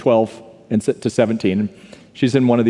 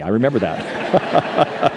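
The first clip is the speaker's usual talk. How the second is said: retelling his daughter's reply with a slow chuckle through it; laughing; much slower than usual. Retold or imitated. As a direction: let the laugh slip in, drawn out. Daughter laughing at old memory.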